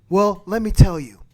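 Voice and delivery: normal talking voice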